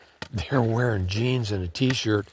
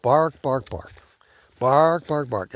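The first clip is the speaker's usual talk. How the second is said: mock barking, a bit louder; imitated dog barking; 3 semitones higher, 3 dB louder, slower than usual. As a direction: bark like the distant ranch dog. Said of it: "And that dog is barking".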